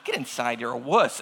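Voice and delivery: mock dismissive. Dad sending crying daughter inside, mock dismissive. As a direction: drop low and dismiss the crybaby.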